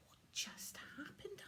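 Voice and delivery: put-on a child asking, baffled and hushed; small wondering boy voice; bewildered boy. Bewildered William after vanishing world.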